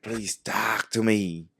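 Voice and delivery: imitated frustration rising to a cry; frustrated plea. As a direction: drag it out, exasperated. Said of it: "really frustrated".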